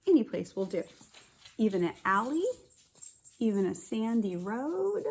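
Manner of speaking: ordinary talk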